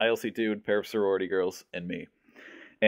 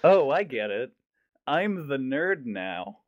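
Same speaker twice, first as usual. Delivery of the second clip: higher, slow, laughing through it; mock self-mocking realization; 5 semitones higher, much slower than usual. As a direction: drag it out as a mock epiphany. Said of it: "And I'm like".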